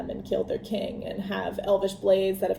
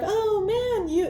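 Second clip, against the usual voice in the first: high-pitched mock awe; retold wowed reaction; imitated amazement; 11 semitones higher, slower than usual. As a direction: play the impressed dwarves, pitch swooping way up. Dwarves' impressed reaction, acted.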